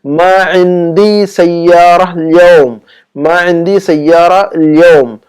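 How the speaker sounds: very slow, syllable by syllable; slow drill. Shown as a demonstration. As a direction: spell it out slowly for learners. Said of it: "तो आप उसको कहेंगे". (बहुत धीरे, हर शब्द अलग)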